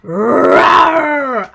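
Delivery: loud playful roar; lion roar. Pretend. Lion roars in the story.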